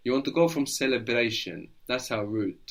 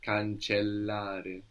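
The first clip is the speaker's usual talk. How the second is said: Cancellare with a small pause on the double L; Cancellare, slow, both L's held; 4 dB quieter, much slower than usual. Shown as a demonstration. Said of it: "It might feel like a little bit of a pause".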